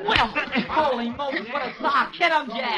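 thrilled shouts from the sidelines; excited cheering. Acted. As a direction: egg him on as the punches land. Crony cheering the fistfight.

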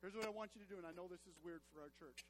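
normal talking voice

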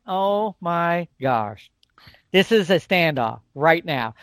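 very slow, drawn-out awe; tense standoff. Retold or imitated. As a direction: stretch it out, frozen in place. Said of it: "And I'm like".

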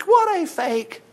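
high, very slow mocking repeat of What a fake; preacher mocking the fake tears. Shown as a demonstration. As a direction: drag out What a fake in a sneering sing-song.

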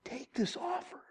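high, very soft plea; hushed pleading. Acted. Spirit of God pleads for people, hushed pleading. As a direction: plead softly, almost whispered. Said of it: "must plead for people to come on".

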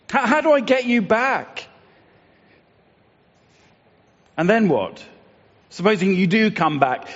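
high, pleading questions from a parent at the end of their rope; desperate parent. Acted. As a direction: beg the child to come home, not knowing how. Parent pleading with lost children.

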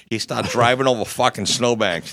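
normal talking voice

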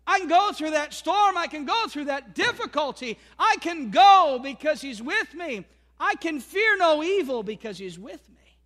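rising, loud preacher's cadence, stretching key words; loud, lifted sermon climax; full-force preaching. Acted. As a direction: build the congregation up, declaring it.

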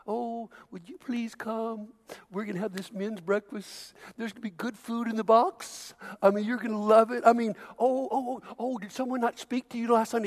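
soft, pleading, overly sweet; pampering coax. Retold or imitated. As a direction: coax like begging a guest. Mocking church pampering.

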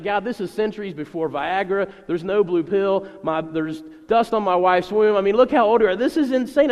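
loud, rising mock incredulity; cynical disbelief. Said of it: "They're just laughing and being cynical".

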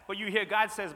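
ordinary talk

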